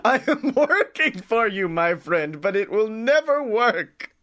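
lightly voiced Watto accent, rising on the pleas; hammed-up Watto haggler accent; pleading Watto accent, played for laughs; mock Watto accent. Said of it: "I turned into Watto".

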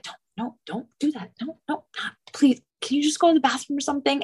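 hushed, hurried pleading, mildly imitated; awkward pleading that speeds up at the end; uncomfortable husband. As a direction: imitate a flustered husband begging under the breath. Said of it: "he gets uncomfortable".

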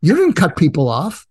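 frazzled, throwing the words back; agitated retort. Retold or imitated. Snapping back at the driver who told him to calm down, agitated. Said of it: "I said".

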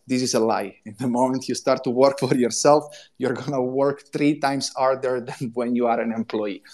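usual speaking voice

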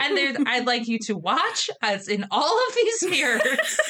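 quoting through laughter; giggling retelling. Retold or imitated. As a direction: barely get the risqué request out for laughing. Risqué quote told through giggles.

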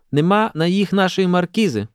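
high schoolgirl scoff; amused scoff. A narrator's reading. Kateryna to her sister about their marquise, tittering. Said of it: "пирснула Катерина".

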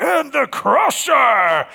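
high, drawn-out announcer's roar; monster-truck arena announcer. Acted. Announcer introducing a monster truck in an arena, hyped up. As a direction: hype the crowd with a slow, booming introduction. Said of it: "sound like one of those things? If you're in some arena".

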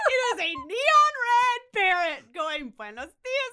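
high, loud, breaking into laughter; laughing incredulously. Real, not acted. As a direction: tell the absurd twist while cracking up. Teller cracking up at absurd twist.

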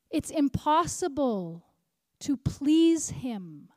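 very slow, pause before "to please him"; slow emphatic preaching. Real, not acted. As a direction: stretch out every word for the congregation. Preacher drives the verse home slowly.

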